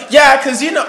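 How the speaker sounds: loud, high mock shouting; loud talker. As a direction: imitate someone who talks too loud. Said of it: "You're like".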